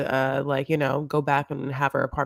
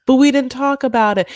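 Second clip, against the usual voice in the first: mock protesting husband; 10 semitones higher, 9 dB louder than usual.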